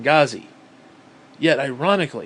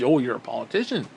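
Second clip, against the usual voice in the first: normal talking voice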